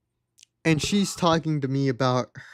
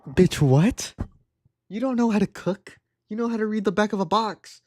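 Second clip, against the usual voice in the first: high-pitched disbelieving comeback; indignant, comic disbelief; incredulous outburst; 4 semitones higher than usual. Acted. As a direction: call out her nonsense, pitch jumping up. Incredulous reaction to her boast.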